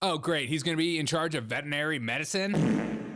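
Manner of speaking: usual speaking voice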